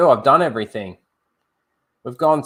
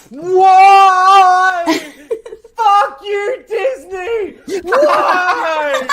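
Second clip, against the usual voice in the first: much higher, louder, wailing mock shout; mock anguished yell; 10 dB louder, much slower than usual. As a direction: howl at Disney in hammed-up despair. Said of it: "I'd be there going".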